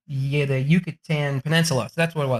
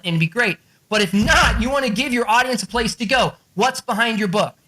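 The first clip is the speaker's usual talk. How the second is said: ordinary delivery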